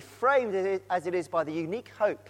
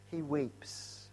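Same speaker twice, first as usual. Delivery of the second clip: softer and lower, hushed echo of He weeps; hushed repeat; 4 semitones lower, 10 dB quieter than usual. Shown as a demonstration. Preacher echoes that Jesus weeps, softer and lower. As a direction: let He weeps fall away quietly.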